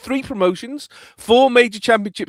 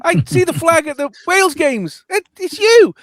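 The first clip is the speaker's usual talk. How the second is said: excited fan; 11 semitones higher, 4 dB louder than usual. Retold or imitated. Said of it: "and she went".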